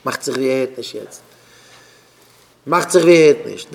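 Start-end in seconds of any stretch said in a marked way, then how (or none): 2.6-3.7 loud, forceful outburst; raised voice, hard attack; shouting. Acted. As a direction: snap it out loudly. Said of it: "wie du schreist".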